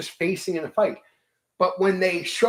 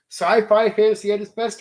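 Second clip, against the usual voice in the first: usual speaking voice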